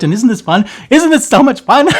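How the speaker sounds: rising to a high, loud squeal; bursting with excitement. Real, not acted. Host overexcited on his return, building to a squeal. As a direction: build to a giddy peak. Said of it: "I'm just bursting".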